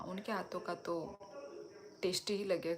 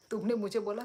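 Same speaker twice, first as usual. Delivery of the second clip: usual speaking voice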